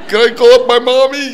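put-on high loud little-kid whine; hammed-up baby voice; childlike whine. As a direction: beg like a small boy for mommy. Asking like a child.

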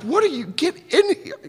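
high, hurried fussing; high impatient neighbor; fussy neighbor. Retold or imitated. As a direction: shoo him in impatiently. Neighbor voiced.

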